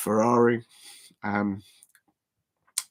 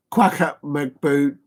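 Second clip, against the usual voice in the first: slow careful naming; 4 dB louder, much slower than usual. Retold or imitated. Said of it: "as Zara says it".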